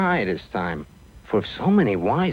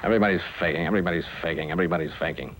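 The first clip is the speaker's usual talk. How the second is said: low, droning doctor's chant; army doctor; 9 semitones lower, 3 dB quieter, much faster than usual. Acted. Sick call doctor dismisses everybody, low rote repetition. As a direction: repeat it in a lowered rote voice. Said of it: "Monroe went on sick call".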